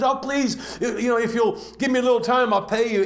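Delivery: high, fast, laughing plea; begging debtor. Acted. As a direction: beg quickly for a little time. Second debtor begs.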